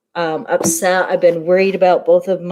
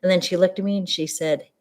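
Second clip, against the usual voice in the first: ordinary talk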